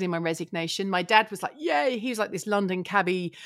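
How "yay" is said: high little cheer; a dad's happy whoop; high, cheery yay. Retold or imitated. Dad's reaction quoted.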